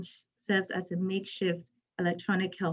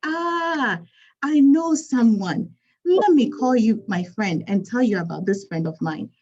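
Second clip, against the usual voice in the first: put-on eager, loud Ghanaian accent; light Ghanaian accent, louder; 3 semitones higher, 7 dB louder than usual. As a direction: offer a friend's contact in a lively Ghanaian accent. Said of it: "I'm going to use my Ghanaian accent here".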